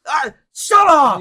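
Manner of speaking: high, loud, slow embarrassed yell; hammed-up embarrassed outburst; flustered yell. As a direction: shout it off like praise burns. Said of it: "Sakura freaking out".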